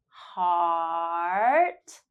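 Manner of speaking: "Heart" with the ah held long. Shown as a demonstration. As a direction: stretch the ah of "Heart". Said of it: "hold ah for three seconds".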